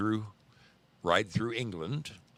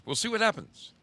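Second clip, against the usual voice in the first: playful echo; 3 dB louder than usual. Real, not acted. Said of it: "repeated phrase, higher second take".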